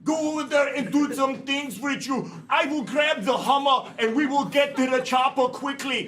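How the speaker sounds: hammed-up Austrian action-hero impression; Arnold Schwarzenegger. Player plans Disney trip as Arnold, action-hero impression. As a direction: imitate his accent with commanding drive. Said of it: "Arnold Schwarzenegger said".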